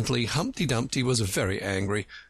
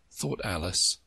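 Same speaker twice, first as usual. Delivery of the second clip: ordinary delivery, nothing marked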